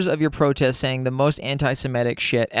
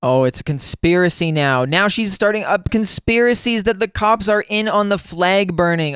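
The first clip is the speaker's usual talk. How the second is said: normal talking voice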